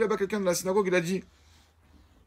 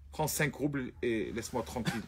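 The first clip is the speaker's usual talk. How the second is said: softer, fed-up brush-off; dismissive; 5 semitones lower, 7 dB quieter than usual. Acted. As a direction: wave him away with a few coins. Rich man brushing off the student.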